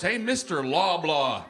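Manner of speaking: slow, drawn-out hello with a laugh; slow laughing hey; cheery greeting. Acted. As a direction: greet him like an old pal. Greeting the store founder.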